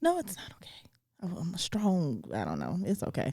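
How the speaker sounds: choked-up whisper, real emotion; softly holding back tears, real; tearful whisper. Real, not acted. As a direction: whisper through tears, fighting them back. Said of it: "why am I getting emotional".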